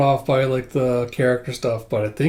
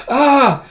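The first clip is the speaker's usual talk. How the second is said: high, loud mock scream; short hammed-up freak-out yell; 12 semitones higher, 8 dB louder than usual. Manga fan acts out Fiona freaking out, mock scream. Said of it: "Fiona's just freaking out".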